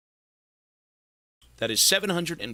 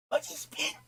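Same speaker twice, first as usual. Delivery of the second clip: whisper; 8 dB quieter than usual. Real, not acted. Whispered command to pet.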